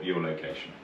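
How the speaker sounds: ordinary delivery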